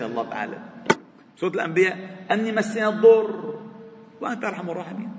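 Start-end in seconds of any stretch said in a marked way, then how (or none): 2.3-5.1 high, lifted chanting recitation; preacher chanting a verse to the congregation; Quran recitation. Acted.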